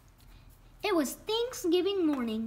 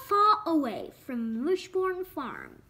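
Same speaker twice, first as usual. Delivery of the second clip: ordinary delivery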